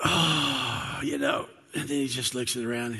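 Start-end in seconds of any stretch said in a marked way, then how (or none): 0.1-1.0 long, breathy mock groan; waking-up groan. Jesus gets up from his nap, groggy acted groan.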